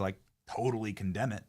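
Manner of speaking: ordinary talk, nothing marked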